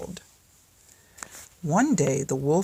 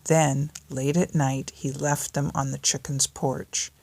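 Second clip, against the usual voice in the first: ordinary delivery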